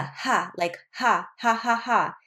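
breathy H sound on each "ha"; Spanish J as H. Shown as a demonstration. Teacher demonstrating the Spanish J, H sound in "ha". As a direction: say "ha" with a clear H, as if laughing. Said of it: "is almost an H sound".

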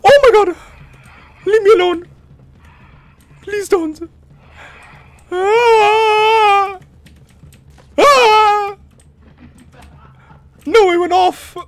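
high piercing screams; terrified screaming. Real, not acted. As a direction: scream in real terror. Caught by monster, screaming.

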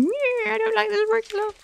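high, squeaky put-on animal voice whining; mock whiny wolf. Streamer voicing a wolf scared of sprinklers, squeaky and whiny. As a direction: play the wolf as a fussy cartoon pet. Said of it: "run away from them going".

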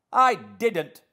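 high, very loud denial; indignant cry. A narrator's reading. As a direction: burst out in loud, high protest. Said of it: "she cried, recovering her speech".

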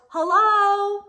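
high, loud cheerful yell; yelled hello. A narrator's reading. A boy yelling hello as he enters kindergarten. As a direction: call hello across the classroom. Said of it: "Xavier Rowe yells".

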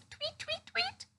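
high, soft chirping tweets; sweet high birdie tweet. Shown as a demonstration. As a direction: chirp like a little bird. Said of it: "Come on, let me hear you, birdies".

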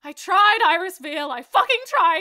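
high, loud yelled line; shouting. Acted. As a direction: belt it out in frustration.

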